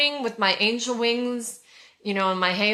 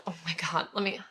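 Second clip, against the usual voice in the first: normal talking voice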